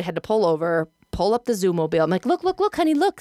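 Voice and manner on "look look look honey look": high, urgent mommy coaxing; retold coaxing. Mom proving it to her son, coaxing. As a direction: coax a child with higher pitch. Showing upset son proof on phone.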